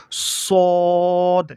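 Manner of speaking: "সদ" with rounded, long held vowel; demonstrated Arabic letter. Shown as a demonstration. Teacher models the letter, long rounded "সদ". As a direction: round and stretch "সদ", closing on the d. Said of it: "এভাবে উচ্চারণ করতে হবে".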